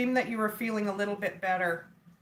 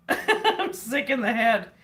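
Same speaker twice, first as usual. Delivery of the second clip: laughing. Real, not acted. Laughing about her own joke.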